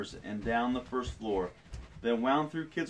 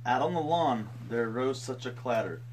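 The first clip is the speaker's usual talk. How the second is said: storytelling. A narrator's reading. Reads picture book aloud.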